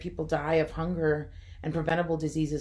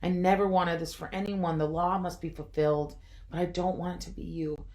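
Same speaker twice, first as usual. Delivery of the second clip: ordinary delivery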